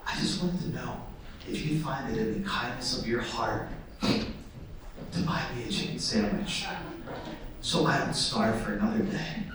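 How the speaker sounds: low, hushed theatrical plea; quiet poetic begging, deep and measured; poetic monologue. Acted. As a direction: deliver a soft, solemn monologue to tug at heartstrings. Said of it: "like, really poetic monologue".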